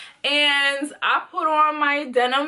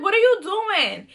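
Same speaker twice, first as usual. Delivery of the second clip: higher, startled what are you doing; shocked protest; 7 semitones higher than usual. Retold or imitated. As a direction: caught off guard as he snatches it. He grabs the shirt from her.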